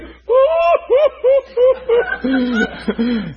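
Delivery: loud high mock wail; hammed-up sobbing howl, trailing in bursts; imitated bawling. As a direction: howl like a man weeping uncontrollably. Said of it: "starts bawling uncontrollably".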